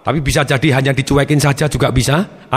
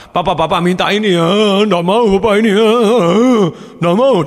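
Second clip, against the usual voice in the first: put-on higher-pitched whiny child begging papa; whining child; 5 semitones higher, 3 dB louder than usual. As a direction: whine and plead like a crying kid. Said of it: "dia nangis, dia merengek".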